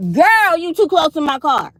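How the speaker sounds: high, loud call-out to a driver. Real, not acted. Woman parked too close to her car, called out high and loud. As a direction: yell it across the lot at her.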